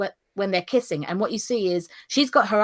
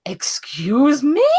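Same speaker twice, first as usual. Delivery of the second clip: slow, affronted and louder, a hint of an indignant old woman; offended excuse me; 6 semitones higher, 6 dB louder, much slower than usual. Mother insulted by a child, a slow affronted excuse me. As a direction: drag it out as if deeply insulted. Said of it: "The audacity".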